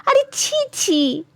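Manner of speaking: high, drawn-out cry of disgust; appalled, pitched well up. A narrator's reading. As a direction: recoil with a slow, high tut of revulsion. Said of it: "মুখ বিকৃত করে বললেন".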